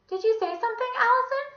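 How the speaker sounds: high, singsong lady; inquiring Miss Mush. A narrator's reading. Visitor asking Allison through the door, high inquiring voice. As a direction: ask it high and lilting.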